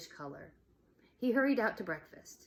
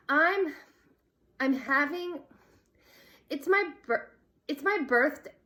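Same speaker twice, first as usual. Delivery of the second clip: nervous blurting; 8 semitones higher, 8 dB louder than usual. A narrator's reading. Nervous girl blurts invitation.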